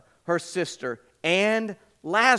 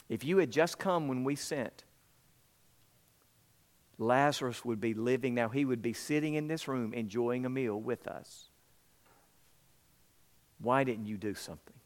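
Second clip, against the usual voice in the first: quiet complaint; 4 semitones lower, 6 dB quieter than usual. A narrator's reading. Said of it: "they both complained to him".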